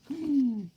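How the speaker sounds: sharp gasp. Acted. Narrator reacts.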